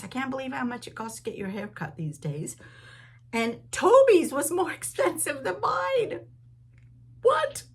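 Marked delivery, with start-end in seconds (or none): 3.3-7.6 high, loud, laughing outrage; what, squealed in shock; laughing disbelief. Real, not acted. Outrage at dog's haircut price.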